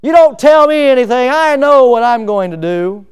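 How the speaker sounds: loud, high defiant outburst; defiant retort. Retold or imitated. Preacher acts a defiant young person, loud. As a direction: throw it back defiantly.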